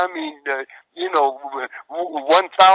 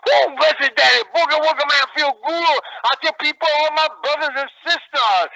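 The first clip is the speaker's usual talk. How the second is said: higher, louder, wild hype voice; over-the-top wrestling promo, pitched up; high-volume hype promo; 10 semitones higher, 3 dB louder than usual. Retold or imitated. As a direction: smoke it out like a crazy preacher of boogie. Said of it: "this is how I talk".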